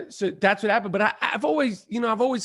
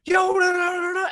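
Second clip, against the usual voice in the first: excited fan shout; 10 semitones higher than usual. Retold or imitated. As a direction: call out like an overjoyed fan running up. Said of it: "was like".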